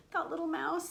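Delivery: ordinary delivery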